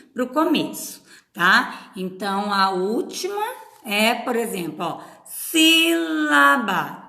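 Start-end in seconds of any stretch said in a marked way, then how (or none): 5.2-7.0 "sílaba" slow and high, SÍ held long; SÍÍ-la-ba, drawn out to show the stress. Shown as a demonstration. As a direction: stretch the first syllable of "sílaba". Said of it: "qual que é a tonicidade de sílaba".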